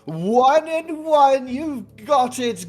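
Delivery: jolly dragon host. Acted. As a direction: cheer the winner warmly. Dragon congratulates Adre.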